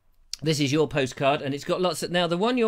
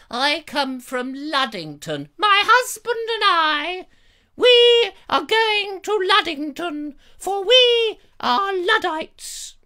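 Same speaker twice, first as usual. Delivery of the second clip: high, slow, louder put-on lady voice; squeaky slow mock-lady voice; hammed-up American lady; 12 semitones higher, 5 dB louder, slower than usual. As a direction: read it like a grand old dame. Reading postcard in funny voice.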